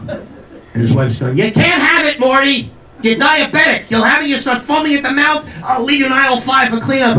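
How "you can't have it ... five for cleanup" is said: high, scolding wife voice; hammed-up nagging old woman; nagging elderly wife. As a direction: scold your husband in a raised, high voice. Acted wife scolding her muttering husband.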